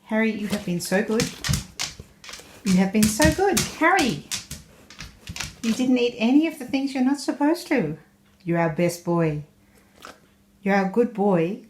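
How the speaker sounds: high, sing-song praise for a pet; high dog praise voice. Real, not acted.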